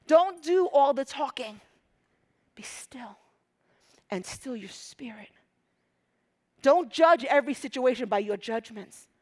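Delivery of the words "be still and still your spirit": hushed unvoiced whisper. Real, not acted. Preacher whispering to the church to be still. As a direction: breathe the words, barely voiced.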